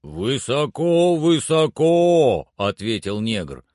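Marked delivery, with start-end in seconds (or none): 0.0-2.5 long drawn-out high shout; calling down. A narrator's reading. Servant answers from high in the tree, drawn-out call. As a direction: stretch each word so it carries a long way. Climber calls down from treetop.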